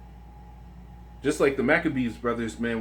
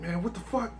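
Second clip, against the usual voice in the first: freaking out; 7 semitones higher, 6 dB quieter than usual. Retold or imitated. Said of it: "he started freaking out".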